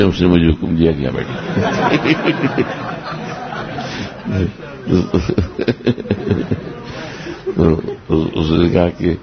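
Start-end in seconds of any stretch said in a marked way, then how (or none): 2.9-8.0 long breathy laugh of amusement. Real, not acted. Telling listeners his retort to the boss, long amused laugh. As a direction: laugh hard, trailing on for several seconds.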